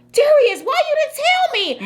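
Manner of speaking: high mock outrage at son; exasperated mom, acted out; retold indignant scolding. Mom retells scolding her son.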